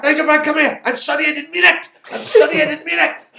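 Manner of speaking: high, pleading, half-laughing apology; mock grovelling teacher. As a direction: beg forgiveness like a scared teacher. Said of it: "He come back".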